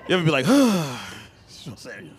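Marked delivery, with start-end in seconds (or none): 0.5-1.2 heavy sigh fishing for attention; long, audible exhale; pointed sigh. Shown as a demonstration. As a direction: sigh so your partner notices. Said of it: "You ever sigh and there really is a problem".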